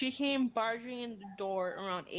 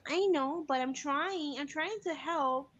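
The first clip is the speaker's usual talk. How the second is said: put-on higher, child-like pleading, slowing at the end; young daughter pleading; 4 semitones higher, slower than usual. Daughter pleading with daddy to help, higher imitated child voice. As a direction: imitate a child insisting she is trying. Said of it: "And then she starts to cry".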